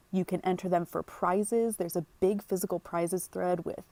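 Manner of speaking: ordinary talk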